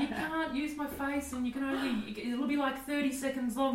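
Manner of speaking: high, giggly retold demand; laughing mock bravado. Telling a bemused friend her daring terms, high and laughing. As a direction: lay down terms through laughter.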